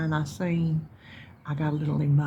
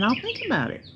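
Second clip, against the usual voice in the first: pitched up high; 9 semitones higher than usual. Retold or imitated. Mom explaining what her maybe meant, said high and lilting. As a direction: sing think up high and let it fall. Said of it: "It meant".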